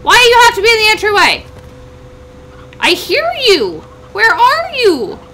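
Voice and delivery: high, loud frantic calls; frantic shouting. Real, not acted. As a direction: yell around for the hidden hen. Hunting a hidden hen in a storm.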